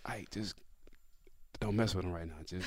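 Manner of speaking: low, very soft warning; hushed teammate. Retold or imitated. Teammate warning Ike off an argument, hushed. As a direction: imitate a teammate quietly steering you away. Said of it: "and R C, like".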